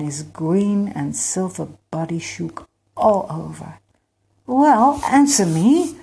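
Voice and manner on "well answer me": loud, high impatient demand. A narrator's reading. Emir to the insect god who keeps laughing, impatient. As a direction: demand an answer with rising impatience. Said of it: "Emir said impatiently".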